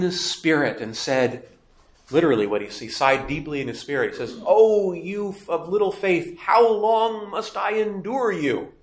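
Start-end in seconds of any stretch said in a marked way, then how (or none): 4.5-8.7 higher, slow, weary lament; full of emotion. Acted. Mark's Jesus sighs deeply at his faithless followers, slow and emotional. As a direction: drag it out like an exasperated sigh. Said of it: "Full of emotion".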